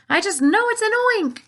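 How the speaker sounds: high, loud certain alarm; panicked cry. A narrator's reading. Fire chief shrieking at the giant peach. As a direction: insist in a frightened shriek.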